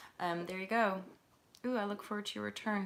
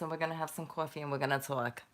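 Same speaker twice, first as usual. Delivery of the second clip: put-on New York talk, lower at first; New York accent; 3 semitones lower than usual. Streamer demonstrates the New York talk, New York accent. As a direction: play a New Yorker from a TV sketch. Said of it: "the New York talk".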